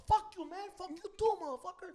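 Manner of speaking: high, loud comeback; mock angry defiance. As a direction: lash out as the riled-up fighter. Defiant reply in retold fight.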